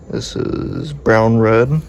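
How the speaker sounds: ordinary talk, nothing marked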